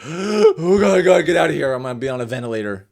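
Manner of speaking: gasp, then high, loud frantic cry; mock panic. Family member in a room at Christmas, hammed-up panic. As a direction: blurt it out in hammed-up terror, easing off fast at the end.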